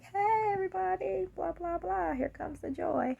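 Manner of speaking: high, bubbly cheerful entrance; happy greeting. Retold or imitated. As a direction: burst in like the joyful one. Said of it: "I'm happy Dee Dee".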